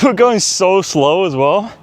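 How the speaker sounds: winded, laughing through it; breathless chuckle while jogging; out of breath. Real, not acted. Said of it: "I'm, like, running out of breath".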